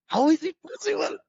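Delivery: high-pitched, astonished question; retold wonder, pitched right up; amazed disbelief. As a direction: imitate a disciple marvelling, voice shooting up. Voices the amazed disciple.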